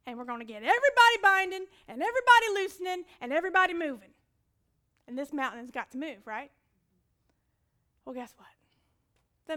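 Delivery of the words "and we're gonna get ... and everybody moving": fast, loud and higher, building the prayer team's zeal; hyped-up excitement. Retold or imitated. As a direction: rattle it off with revival fervour.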